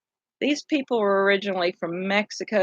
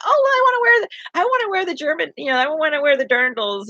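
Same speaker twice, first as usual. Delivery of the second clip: whiny pleading; 8 semitones higher, faster than usual. Retold or imitated. Pleading to wear German costume.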